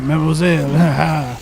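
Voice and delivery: slow, hammed-up French accent. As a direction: drawl it in a silly French accent. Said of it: "prefer me not to be French".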